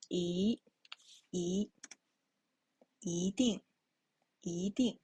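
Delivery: 一定 as yídìng, 一 rising; teacher demonstrating 一定. Shown as a demonstration. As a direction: model 一 rising before 定. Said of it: "E should be the rising tone".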